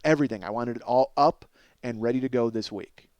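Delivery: normal talking voice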